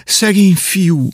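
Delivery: lamenting cry. Acted. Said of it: "s ezt kiáltozták".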